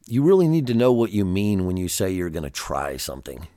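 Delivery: ordinary talk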